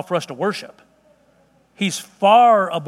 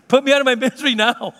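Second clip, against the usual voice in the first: hammed-up whining child; 5 semitones higher than usual. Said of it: "with the wailing and the, you know, crying".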